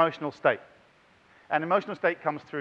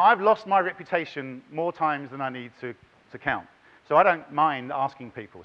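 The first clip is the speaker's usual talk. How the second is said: usual speaking voice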